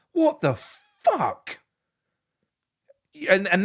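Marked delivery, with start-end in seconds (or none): 0.1-1.6 drawn-out, higher cry of disbelief. Retold or imitated. Fan recalling seeing the crowd leave, slow disbelieving cry. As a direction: stretch it out in stunned outrage. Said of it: "you're like".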